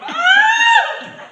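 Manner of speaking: very high, loud shriek; scream. Acted. Husband mimics his wife pulling at his gun arm, screaming. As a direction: scream at full pitch as the wife. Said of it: "Myra screams and pulls my arm".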